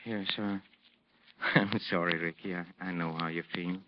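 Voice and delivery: low, soft and subdued; chastened apology. Acted. Maestro apologizing after Ricky cuts him off, chastened. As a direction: back down meekly, voice dropped.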